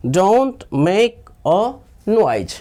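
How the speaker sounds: slow, every word separate; slow drill. Shown as a demonstration. Teacher answering "what do we say" for learners, slow drill. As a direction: model it slowly for repeating.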